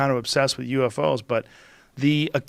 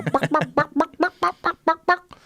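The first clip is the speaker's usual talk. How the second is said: high, rising buck-buck turkey call, climbing higher each time. Retold or imitated. Hunter describing turkey hunting, imitating the turkey call. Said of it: "You go".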